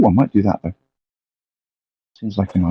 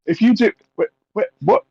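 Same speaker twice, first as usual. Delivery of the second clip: confused NPC; 12 semitones higher, faster than usual. Acted. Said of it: "Sal's gonna turn around and go".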